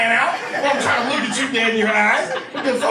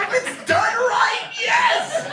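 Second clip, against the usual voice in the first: very high, slow, stretched yell of yes; ecstatic cheer; 12 semitones higher, much slower than usual. Retold or imitated. Answering the nephew's Go-Gurt question, a stretched high squeal. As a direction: squeal it like the best news ever.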